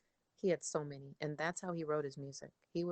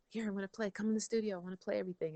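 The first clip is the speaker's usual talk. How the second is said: higher-pitched imitation of an excited dad; 6 semitones higher, 3 dB louder, faster than usual. Dad inviting daughter into the studio, excited. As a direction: eagerly urge like a kid, imitating dad. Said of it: "He's all excited".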